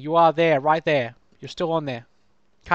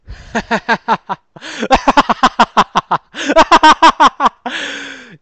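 long, high, loud shrieking laugh; screaming laugh; 6 semitones higher, 9 dB louder than usual. Real, not acted. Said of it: "I do the three-year-old screams".

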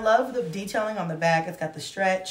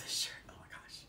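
whisper; 15 dB quieter, faster than usual. Real, not acted. Excited reaction to the shirt, whispered.

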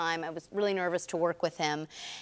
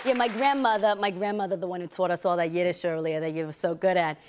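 thick put-on Long Island accent; exaggerated Long Island vowels; grandmother's Long Island accent; 2 semitones lower than usual. Shown as a demonstration. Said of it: "She talks like this".

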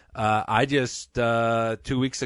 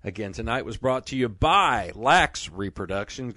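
ordinary delivery

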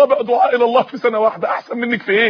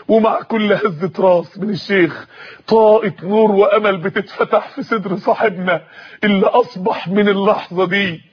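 usual speaking voice

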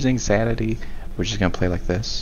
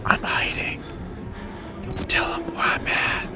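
voiceless, soft whisper; hushed whisper; 7 dB quieter than usual. Real, not acted. Player hiding from an enemy, urgent whisper. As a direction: whisper so as not to be found.

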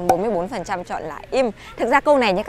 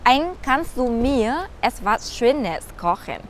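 repeated even slower, every word drawn out; 3 semitones higher, much slower than usual. Shown as a demonstration. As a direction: go over it again very slowly for learners. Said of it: "các bạn sẽ nói là". (nhắc lại thật chậm từng chữ)

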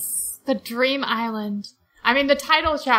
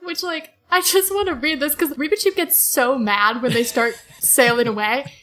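ordinary talk

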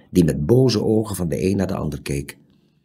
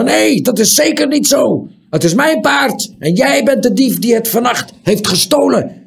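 much higher, loud furious bellow; 6 dB louder than usual. A narrator's reading. Furious bellow. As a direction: owner bellows at the thief.

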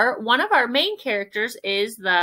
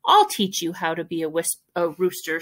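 ordinary delivery, nothing marked

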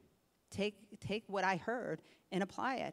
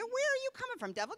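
sudden high commanding rebuke; retold rebuke of the devil; rebuking the devil; faster than usual. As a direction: call out the devil sharply.

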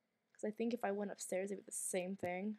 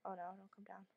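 hushed and urgent, voice dropped to a whisper; whispered plea; 10 dB quieter, faster than usual. Real, not acted. Hiding player whispers at game characters, urgent. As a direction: beg them not to come, barely breathing.

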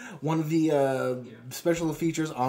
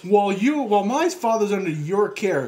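high, loud mock confrontation; acted indignation; 6 semitones higher, 6 dB louder than usual. Man confronting hospital staff about father's care, mock indignant. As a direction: play the outraged doctor, half laughing.

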